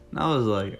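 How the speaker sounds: usual speaking voice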